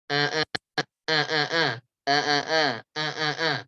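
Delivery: eh eh with the nose pinched shut; pinching nose. Shown as a demonstration. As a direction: say eh, eh holding your nose closed. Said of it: "saya tutup hidungnya".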